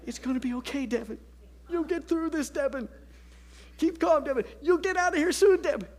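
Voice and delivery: a frazzled dad barely holding it together; self-soothing. Retold or imitated. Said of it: "I'm Devin".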